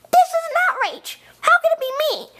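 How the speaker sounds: high-pitched indignation; outraged protest. Acted. Indy objects to being blamed for the reveal, outraged.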